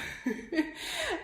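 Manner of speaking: high breathless giggle; breathless laugh. Real, not acted. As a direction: laugh it off, winded.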